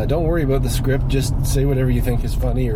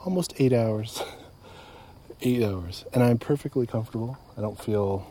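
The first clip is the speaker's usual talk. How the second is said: calm and soft; 3 semitones lower, 7 dB quieter than usual. Real, not acted. Calm sunset update, softer.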